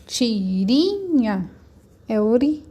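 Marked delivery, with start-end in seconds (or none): 0.0-1.5 "Tirinha" slow, the "ri" held long; drawn-out stressed syllable. Shown as a demonstration. Teacher asking which syllable is strongest, drawn-out "ri" in "Tirinha". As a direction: sound out "Tirinha" slowly, dwelling on "ri". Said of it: "É ri".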